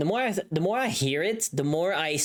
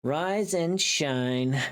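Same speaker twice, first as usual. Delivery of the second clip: slow trial read; much slower than usual. Shown as a demonstration.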